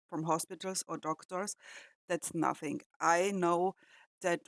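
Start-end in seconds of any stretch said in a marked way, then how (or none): none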